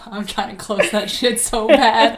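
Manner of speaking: real laughter breaking into the words; laughing. Real, not acted. Friend admitting she cannot close the portal, laughing.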